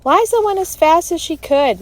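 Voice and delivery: indignant interruption; interrupting defense. Acted. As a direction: jump in loud and high for a friend. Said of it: "Melody interrupted".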